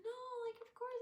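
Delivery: high-pitched sweet insistence; kind leader imitation.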